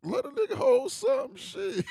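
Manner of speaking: high-pitched joking beg; high squeaky plea. Acted. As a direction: whine it up high, half laughing.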